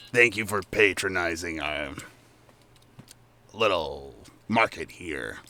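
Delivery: a low, rumbling shopkeeper goblin; deep gravelly Chucklehead. Acted. Shopkeeper goblin thanks the party in a low voice. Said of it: "Chucklehead comes back up and he's like".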